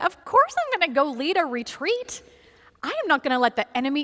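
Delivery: high, hammed-up insistence; mock your own gung-ho resolve, speeding up; overconfident bravado. Mocking own earlier bravado.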